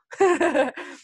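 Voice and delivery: short burst of laughter; bright laugh. Real, not acted. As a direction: laugh out loud. Laughing at her own joke.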